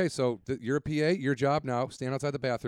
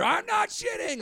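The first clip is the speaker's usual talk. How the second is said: defensive shout; slower than usual. Retold or imitated. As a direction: shout it back, caught and denying. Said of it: "in a defensive tone".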